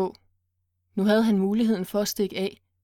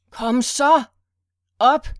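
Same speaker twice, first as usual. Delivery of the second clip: loud, higher barked orders; roaring; 4 semitones higher, 8 dB louder, slower than usual. Acted. Kidnapper yells at Monique to get up, roaring. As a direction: bellow at her to get up. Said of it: "brølede han igen".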